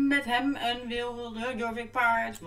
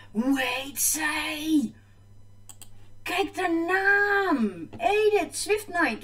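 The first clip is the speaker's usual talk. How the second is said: amazed; 7 semitones higher, 3 dB louder, much slower than usual. Real, not acted. Amazed at another player's name.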